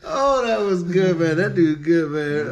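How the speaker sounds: high, loud, slow laughing praise; co-host cracking up; laughing delight. Real, not acted. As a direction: savor the joke while still laughing. Host laughing after bit.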